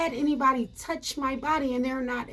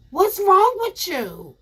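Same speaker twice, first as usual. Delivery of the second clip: outraged; 5 semitones higher, 5 dB louder than usual. Retold or imitated. Outraged at the man.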